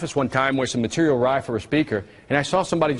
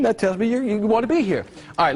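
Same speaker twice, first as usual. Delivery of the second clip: ordinary delivery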